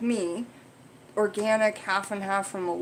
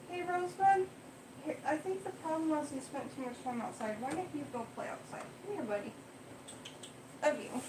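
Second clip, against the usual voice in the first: high, soft, soothing pet voice; comforting the cat; 8 semitones higher, 13 dB quieter, faster than usual. Real, not acted. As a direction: coo gently to calm a scared kitty.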